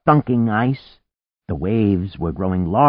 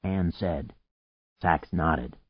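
ordinary delivery, nothing marked

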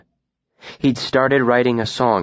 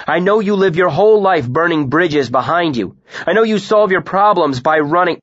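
loud, higher hurtful accusations; raised, relentless blame; angry accusation; 7 semitones higher, 4 dB louder than usual. A narrator's reading. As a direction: wound her with words.

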